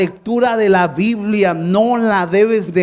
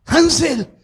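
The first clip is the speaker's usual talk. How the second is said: mother calling out; 7 semitones higher than usual. Retold or imitated. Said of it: "ella siempre me gritaba".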